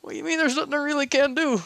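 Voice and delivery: high, fast panicked outburst; frantic protest. Acted. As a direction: rush it, alarmed.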